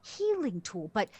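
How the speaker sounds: high opening settling toward mid pitch; animated character voice, starting high; assessing character. Acted. Doctor character explaining the healing helmet's risk, animated puzzled voice. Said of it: "She goes".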